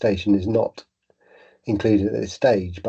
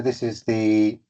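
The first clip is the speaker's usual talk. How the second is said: muffled, as if face buried in fur; slower than usual. Real, not acted. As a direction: talk through a blocked nose with a cold. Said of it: "your voice is quite muffled".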